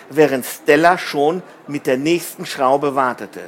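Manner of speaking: ordinary delivery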